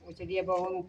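mother-in-law mutters a mocking echo of the guest. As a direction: parrot her words back under your breath.

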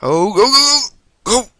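high, loud burst of alarm; urgent urging. Real, not acted. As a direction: urge the rider on in a panic. Urging the game character on.

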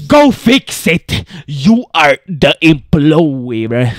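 loud, drawn-out scolding; hammed-up exasperated order; indignant outburst. Bossing back the shop employee.

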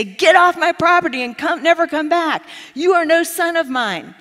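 loud, high outburst from a rejecting father; imagined angry father. Retold or imitated. Preacher voicing an imagined father shouting at his son, loud and angry.

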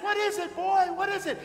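high-pitched, startled grandfather's question; urgent questioning. Acted. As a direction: answer the commotion with urgent alarm. Grandfather answers the screaming boy.

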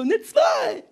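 very high, triumphant shout; proud announcement. Retold or imitated. Son proudly telling his mother his maths grade, shouting it. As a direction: belt out the good grade like a trophy.

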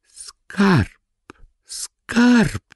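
high, loud joyful cry of discovery; excited cry. A narrator's reading. Poor woman finding silver coins, crying out high and loud. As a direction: shout it out, stunned by the glittering coins. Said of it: "zakrzyknęła kobieta".

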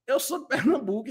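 normal talking voice

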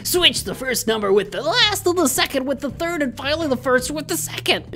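very high, swooping clown voice; a goofy clown giving riddles; silly clown. Acted. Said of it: "that's my clown voice".

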